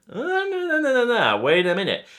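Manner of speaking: high, rapid string of noes; emphatic no. Pretend. Warning a new player off the obvious plan, high and emphatic. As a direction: stop them cold with a high no no no. Correcting the naive player's idea.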